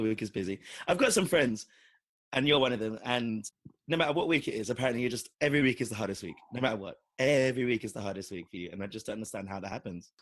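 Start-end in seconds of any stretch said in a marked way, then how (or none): none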